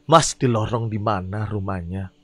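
low, slow driver voice; deep driver voice. Acted.

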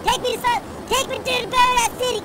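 high, loud strained holler; frantic high-pitched yelling; yelled lyric. Real, not acted. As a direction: belt it out while struggling. Yelling lyric while struggling in game.

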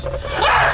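high startled shriek; real scream. Real, not acted. Player interrupted by a jump scare, screaming. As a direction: shriek mid-thought in fright. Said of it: "because I'm screaming".

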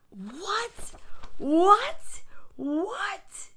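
high, sharply rising questions; disbelieving kid. A narrator's reading. Boy stunned his toys must go, rising disbelief. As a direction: squeak each one upward.

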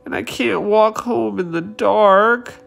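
high, whiny little bee; a touch of wailing. Acted. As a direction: complain about the dark, pitifully. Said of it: "wailed the greedy bee".

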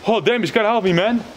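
sudden frightened yelp, pitched high; startled and pleading for help; scared. Real, not acted. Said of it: "I just scared, uh, like crazy".

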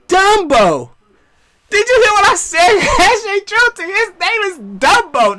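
loud, high, incredulous repeat; high-pitched teasing emphasis with a laugh; gleeful mockery. Real, not acted. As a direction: crow the silly name up high. Mocking the opponent's name, repeating it.

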